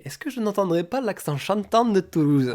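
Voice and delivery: high, slow, sing-song mock question; mimicked French tourist. Frenchwoman to the travellers from Toulouse, mimicked in a high sing-song. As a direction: imitate a stranger delightedly spotting an accent. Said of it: "et qui nous dit".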